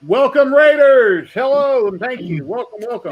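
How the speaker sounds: loud, high, slow greeting; excited welcome. Real, not acted. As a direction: welcome them grandly, stretched out. Greeting raiders on stream.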